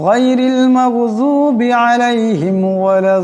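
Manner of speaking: full melodic recitation of Al-Fatiha; Quran recitation. Shown as a demonstration. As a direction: chant the full surah slowly. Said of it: "সম্পূর্ণ সূরাটি তিলাওয়াত করব".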